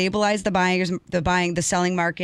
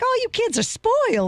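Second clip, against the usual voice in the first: much higher, mock old Southern lady accent; Southern grandma accent. Grandma scoffing at Becky over rates, Southern accent. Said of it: "She's like, with a little Southern accent".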